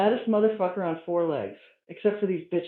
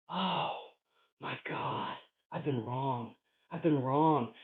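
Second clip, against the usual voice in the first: hushed, low and breath-soft; stunned realization; 5 semitones lower, 7 dB quieter, slower than usual. Retold or imitated. Man realizing in his hotel he was wrong, hushed awe. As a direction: let it sink in, barely voiced. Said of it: "And it made me say".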